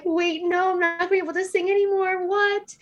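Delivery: high-pitched mock panic; alarmed disbelief. Retells her shocked reaction.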